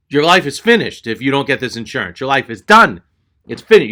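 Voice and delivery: loud, punched doom-saying; dire overdramatic warning. Retold or imitated. Clerk scaring customer into buying insurance, overdramatic. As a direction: hammer it home like a hammed-up threat.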